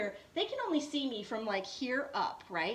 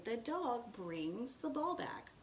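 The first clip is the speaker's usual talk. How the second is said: slow teacher demo; 8 dB quieter, slower than usual. Shown as a demonstration. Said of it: "and I say".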